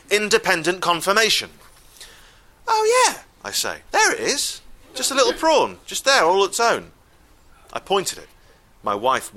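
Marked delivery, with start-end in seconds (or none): 2.6-3.2 much higher, louder gloating Oh yeah; gleeful. Retold or imitated. Husband gloating to the waiter over the prawn, much higher. 3.9-6.9 higher, louder sing-song gloat; gleeful pointing. Retold or imitated. Husband showing the waiter the leftover prawn, higher gloating.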